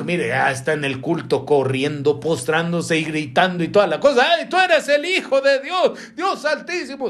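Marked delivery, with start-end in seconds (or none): 4.4-7.1 high-pitched mock cry of a possessed man; acted crying out. Preacher acts out a shouting worshipper in church, high mock cry. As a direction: cry out high, without much extra volume. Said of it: "y gritando a gran voz dijo".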